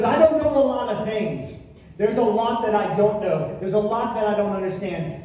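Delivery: loud, high, driving sermon climax; full-force preaching. Acted. Pastor proclaiming the Redeemer lives, loud and fervent. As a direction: preach it with all you've got.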